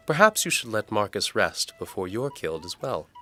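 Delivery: Galena's voice. Acted. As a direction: voice the maid brightly and politely.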